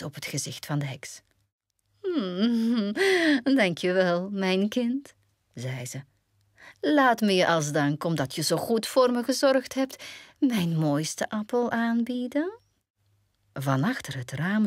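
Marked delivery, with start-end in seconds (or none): 2.0-3.5 hoge gemene gniffellach van een heks; boze heksenlach. Acted. Heks grijnst voldaan. 3.6-12.6 licht gespeelde oude heks, poeslief; zoete heksenstem. Acted. Heks doet vriendelijk tegen meisje.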